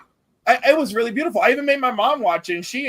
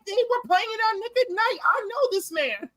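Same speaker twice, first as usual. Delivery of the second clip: put-on very high, eager kid protest; excited insistence; 11 semitones higher than usual. Young self proving to father they know the actor, excited. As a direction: insist in a high voice, slowing at the end.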